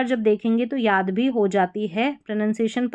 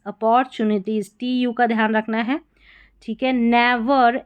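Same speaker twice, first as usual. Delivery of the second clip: ordinary talk, nothing marked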